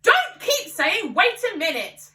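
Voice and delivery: slow, high, loud shout. A narrator's reading. Father shouts down George's objection, high and loud. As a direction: cut him off impatiently. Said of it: "shouted Mister Cranky".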